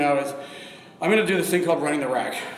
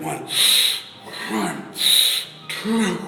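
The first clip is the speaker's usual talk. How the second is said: effortful counts between sharp breaths; real exertion in short bursts; straining while lifting; 2 semitones higher, 3 dB louder, much slower than usual. Real, not acted. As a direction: puff out each count under the weight. Counting reps in the next set.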